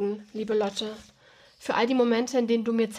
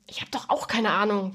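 ordinary delivery

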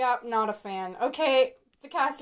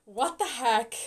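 ordinary talk

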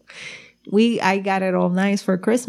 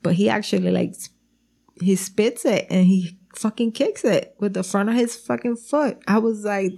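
usual speaking voice

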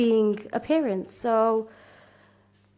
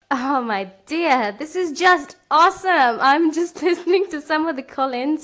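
excited; 5 semitones higher, 4 dB louder than usual. Real, not acted. Delighted by the call-ins.

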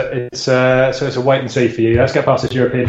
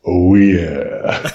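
very low, boomy mic voice; deep and muffled like a bad mic; 6 semitones lower than usual. Real, not acted.

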